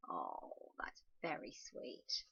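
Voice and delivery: soft slow whisper; touched whisper at the story's end; tender whisper. Real, not acted. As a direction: say it gently, touched. Reacting to the last page.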